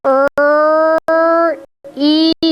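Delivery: "er" then "e" as two separate sounds; counting sounds for the class; sounds segmented. Shown as a demonstration. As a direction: pull the syllable apart into its two sounds. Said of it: "So it's gonna go".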